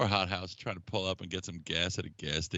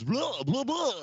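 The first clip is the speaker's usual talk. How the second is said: much higher, slow vampiric drone like a cereal-box count; Mind Wipe voice; 4 dB louder, slower than usual. Retold or imitated. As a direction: imitate a campy Count Chocula vampire. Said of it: "He talked like this".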